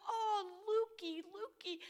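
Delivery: high, slow tender coo over a hurt finger; cooing grandma. Retold or imitated. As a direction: soothe a hurt little boy, drawing out Lukey.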